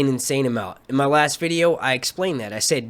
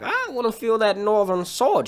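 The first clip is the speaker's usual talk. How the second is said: high-pitched imitation of her; teasing high-voiced mimicry; mock female come-on; 8 semitones higher than usual. Retelling a woman's come-on.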